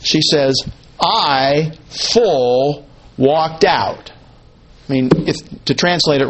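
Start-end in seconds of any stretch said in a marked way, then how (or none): 1.0-4.1 I and FULL set apart as separate stressed words before "walked out"; emphatic quote. Acted. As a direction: pause after "I" and after "full". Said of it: "the emphasis is on herself".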